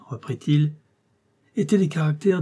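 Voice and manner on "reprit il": ordinary delivery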